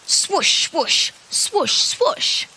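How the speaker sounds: breathy whooshing wiper sound; rain patter sounds. Shown as a demonstration. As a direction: whisper the swoosh like wipers. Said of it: "So try and guess what this one is".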